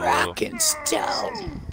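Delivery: put-on high drunken cheer; rowdy party animal. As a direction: whoop it out like a reveller. Dwarf cheer shouted.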